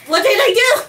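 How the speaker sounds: loud, high, startled cry; startled shout with pitch shooting up; alarmed surprise. Real, not acted. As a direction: yell it in shock. Statue's eyes suddenly light up.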